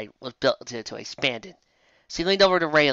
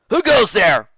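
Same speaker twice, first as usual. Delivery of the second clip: louder guard challenge; guard's challenge; 8 semitones higher, 9 dB louder, slower than usual. Acted. Guard hears hooves, louder challenge. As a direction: call out a challenge.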